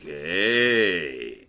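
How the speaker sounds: low, lightly voiced Goodman greeting; low casual hey; John Goodman impression. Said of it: "John Goodman's like".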